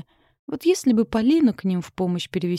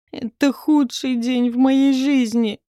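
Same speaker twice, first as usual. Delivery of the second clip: loud, slow, higher moaning wail; groaning complaint; 6 semitones higher, 5 dB louder, slower than usual. A narrator's reading. Dan in the car groaning about his awful day. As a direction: moan it out like the worst day ever. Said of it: "простонал Дан".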